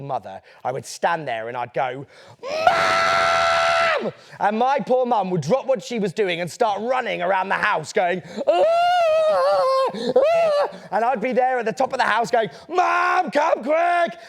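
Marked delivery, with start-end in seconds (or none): 2.4-4.1 very high, long-drawn yell of "Mum"; child's scream for mum. Acted. Boy at the top of the house yelling for his mum, piercing scream. 8.5-10.7 high, loud wail while running about; hammed-up frantic shriek; mock panicked scream. Poor mum running around the house in alarm, hammed-up scream. 12.7-14.2 high, loud mock alarm; urgent shout for mum. Boy tricking his mum from the top of the house, urgent high yell.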